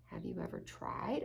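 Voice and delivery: unvoiced soft ask; hushed little dog; softly whispered question. Hugo asks softly.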